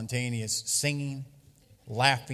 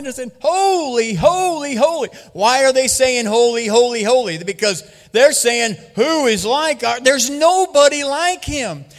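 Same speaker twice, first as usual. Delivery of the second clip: preaching at full force; 11 semitones higher, 10 dB louder than usual. Acted. Preacher proclaims the vision of Christ to the church, loud and high. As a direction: proclaim the vision with rising fire.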